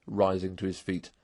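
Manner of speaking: usual speaking voice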